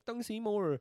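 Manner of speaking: high, loud burst of mock Japanese; hammed-up imitation of spoken Japanese. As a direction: blurt out fake Japanese.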